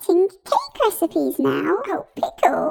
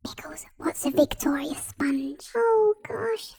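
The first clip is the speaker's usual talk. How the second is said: whispering; 3 semitones lower, 7 dB quieter than usual. Acted. Puzzled whisper about the cake.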